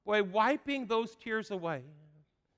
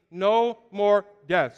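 loud, slow No more death; call to repeat; 7 dB louder, slower than usual. Shown as a demonstration. Preacher leading congregation in unison, slow and loud. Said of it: "Say that with me".

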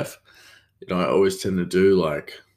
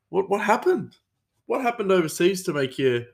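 much higher, baffled mate; baffled questioning; 10 semitones higher than usual. Acted. Cook asking his changed coworker what happened, baffled. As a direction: pitch it up in disbelief.

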